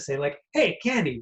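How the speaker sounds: ordinary talk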